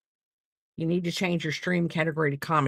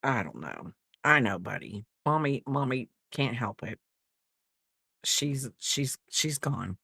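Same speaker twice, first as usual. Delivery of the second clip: much lower and softer, cooing to the dog; tender to pet; 8 semitones lower, 4 dB quieter than usual. Real, not acted. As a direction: murmur gently to a buddy. Talking softly to her pet.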